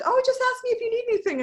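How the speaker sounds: chirpy mock voice of well-meaning people; mock breezy helper. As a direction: parody the easy promise of help. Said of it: "people will always be like".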